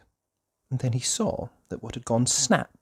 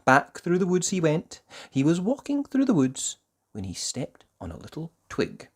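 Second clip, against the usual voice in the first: plain reading voice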